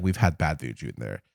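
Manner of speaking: low, soft imitation of staff; hushed warning. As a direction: confide it like an uneasy employee. Acting the staff's grave warning.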